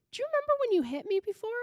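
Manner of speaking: put-on high, quick, casual kid voice; mock reminiscing. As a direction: imitate a child chattily recalling old times. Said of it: "like they're reminiscing".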